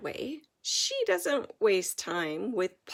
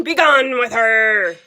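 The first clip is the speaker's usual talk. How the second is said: very loud booming command; royal banishment; 17 dB louder than usual. A narrator's reading. King ordering the girl away, booming loudly. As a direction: bellow the king's order to banish her.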